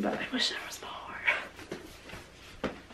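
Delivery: slow whispered wish; wistful whisper. Real, not acted. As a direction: sigh it out softly. Wistful aside about the duology.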